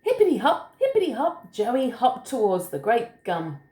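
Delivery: lilting, high bouncy hops, then settling; story reading to children. A narrator's reading. Adult reading a picture book to a small child, lilting.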